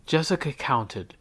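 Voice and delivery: ordinary delivery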